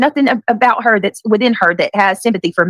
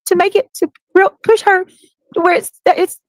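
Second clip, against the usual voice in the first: much higher mock whiny voice, hammed-up; mocking her crying; 11 semitones higher than usual. Mocking a rival's tearful live, high and whiny. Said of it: "she says".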